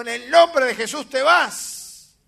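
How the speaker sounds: loud, slow commanding shout; shouted rebuke. Retold or imitated. Preacher rebuking a demon in the night, loud slow shout. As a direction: drag the command out and blast it.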